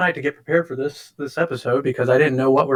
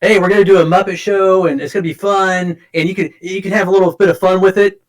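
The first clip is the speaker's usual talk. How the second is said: louder, higher show-host enthusiasm; mock upbeat pitch; 5 semitones higher, 4 dB louder than usual. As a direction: sell the show with playful cheer. Acting out an upbeat meme quote.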